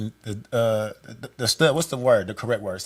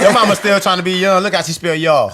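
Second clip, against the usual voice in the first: laughing tease; 3 semitones higher, 8 dB louder than usual. Real, not acted. Laughing teasing about mama.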